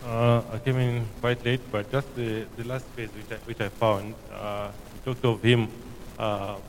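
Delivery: muffled behind a face mask, soft; speaking through a mask, hard to hear. Real, not acted. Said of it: "Sorry, maybe it's the mask, I can hardly hear".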